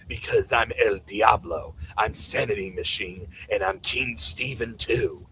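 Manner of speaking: low, slow mocking boast; lightly voiced villain bragging, slowed down; Sanity Machine impression. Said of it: "that's my impression of Sanity Machine, that loser".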